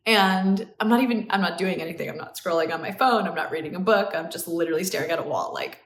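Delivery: ordinary delivery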